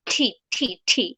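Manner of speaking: "ঠি" in crisp, separate aspirated bursts; true T. Shown as a demonstration. Teacher demonstrating the true T, isolated aspirated syllables. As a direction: puff out each "ঠি" on its own. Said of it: "pronounce হবে এইভাবে".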